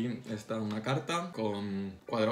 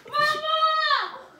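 shouting for mum; calling out loudly; 9 dB louder than usual. Real, not acted. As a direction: yell across the house for mum. Calling mum during tickle fight.

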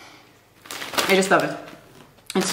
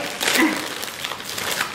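soft voiceless laugh; breathless giggle; 5 semitones higher, 5 dB quieter than usual. Real, not acted. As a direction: let out a quiet breathy giggle. Laugh after opening.

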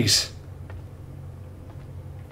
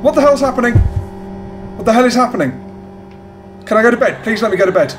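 high, loud frantic pleading; terrified panic; 8 semitones higher, 3 dB louder, faster than usual. Real, not acted. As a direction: scream to be let go to bed. Terrified ending of horror game.

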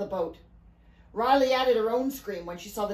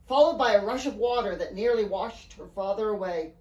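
ordinary delivery

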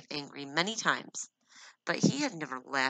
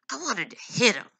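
angry and louder; 7 semitones higher, 7 dB louder than usual. Acted. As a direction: let the anger burst out. Paul in an angry outburst.

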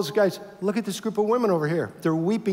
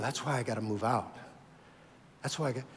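sorrowful God; 5 semitones lower, 9 dB quieter, faster than usual. Acted. God grieved telling Ezekiel.

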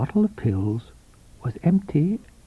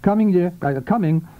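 a touch higher, hurried reply; hasty guilty husband; 5 semitones higher than usual. A narrator's reading. Guilty husband calling back to his sick wife, hurried. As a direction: answer too quickly, hiding guilt. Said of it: "hands still trembling".

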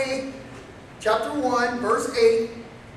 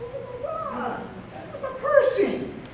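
higher, startled exclaim; a digger suddenly spotting a tiny man, higher and startled; astonished; 9 semitones higher, 6 dB quieter, slower than usual. Retold or imitated. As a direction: gasp it out in disbelief. Shock at seeing a pygmy.

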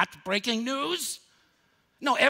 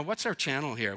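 normal talking voice

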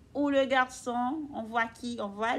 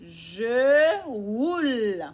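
put-on reading to children; 4 dB louder, much slower than usual. Shown as a demonstration. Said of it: "Avec son vélo".

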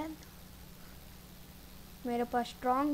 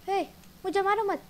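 higher, louder protest; alarmed plea; 6 semitones higher, 4 dB louder, faster than usual. Real, not acted. Player begging a mob not to hit, alarmed. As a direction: plead with the attacker to back off. Player attacked, begs mobs to stop.

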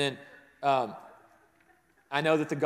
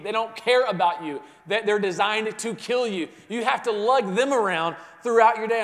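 1 preaching; 4 semitones higher, 6 dB louder than usual. Acted. Preaching raised again.

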